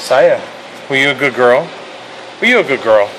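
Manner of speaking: loud, high excited dog greeting; talking to a dog. Real, not acted.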